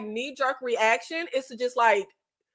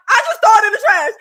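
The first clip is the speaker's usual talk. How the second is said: loud emphatic; 7 semitones higher, 8 dB louder, faster than usual. Real, not acted. Repeats louder, emphatic.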